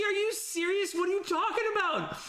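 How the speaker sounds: floored by something absurd; incredulous. Real, not acted. Streamer answering Chevron's comment, high incredulous outrage. Reacting to viewer's piracy comment.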